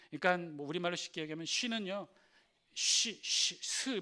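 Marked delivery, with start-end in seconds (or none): 2.7-4.0 쉬 as a hushed sh sound, then 스; sound demonstration. Shown as a demonstration. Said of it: "뭐 이런 발음이고요". (쉬, 쉬, 스 발음 시범)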